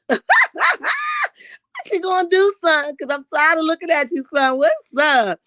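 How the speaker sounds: high-pitched mock confrontation; Miss Marlene. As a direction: challenge him, pitched right up. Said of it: "Miss Marlene is like".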